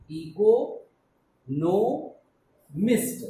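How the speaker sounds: Eco, no, mist said loud as three separate beats; syllable by syllable. Shown as a demonstration. Teacher showing pupils the syllables, loud and separated. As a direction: break the word into clear syllables. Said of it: "So how do we pronounce this word?".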